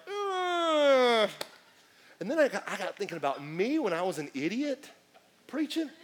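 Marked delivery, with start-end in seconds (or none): 0.1-1.3 disgusted groan. Retold or imitated. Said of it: "And I was like".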